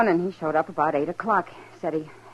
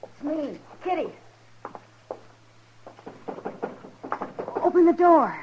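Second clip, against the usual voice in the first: calling through door; 10 semitones higher than usual. Acted. Kitty luring the fugitive to open up, calling through door. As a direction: call out to be let in, casual cover.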